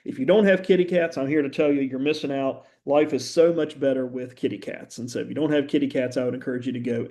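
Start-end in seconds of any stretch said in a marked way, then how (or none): none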